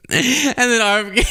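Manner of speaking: ordinary talk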